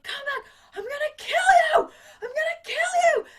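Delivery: high, loud hammed-up villain scream; murderous yell. Villain chasing Annie up the bridge, high loud threatening scream. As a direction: chase her down, shrieking threats. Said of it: "Tim Curry's like".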